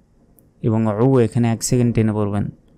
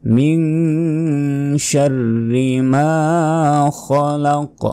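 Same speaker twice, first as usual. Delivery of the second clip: higher, very slow, chanted tilawat; slow, sung Quran recitation; 5 semitones higher, much slower than usual. Shown as a demonstration. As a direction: sing the verse slowly and high.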